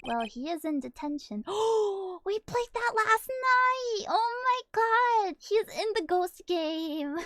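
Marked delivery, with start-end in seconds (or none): 1.5-7.2 high, loud, slow excited exclaim; excited gasp. Real, not acted. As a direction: burst out in recognition. Sudden excited realization.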